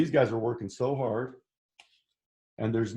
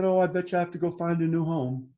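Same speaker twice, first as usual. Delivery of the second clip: higher, level, forlorn little voice; mildly sad dog; 6 semitones higher than usual. Acted. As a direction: voice the dog's mild dejection on a raised pitch. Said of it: "Look how sad he was".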